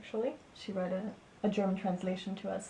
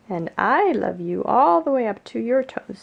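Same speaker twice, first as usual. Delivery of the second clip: big hare's reply, leaning on I and all; loud, swooping answer that tops the little one; 3 semitones higher, 12 dB louder than usual. A narrator's reading. Big hare answers Little hare, emphatic and affectionate. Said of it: "said Big Nut Brown Hare".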